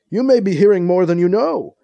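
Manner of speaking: hint knowingly at a secret; mysterious. A narrator's reading. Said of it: "Van Kilner adds mysteriously".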